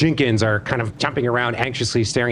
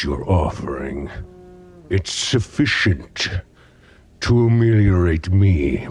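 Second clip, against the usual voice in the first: regal gremlin boss; 7 semitones lower than usual. Acted. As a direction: rumble it slow and lordly. Gremlin boss grants audience.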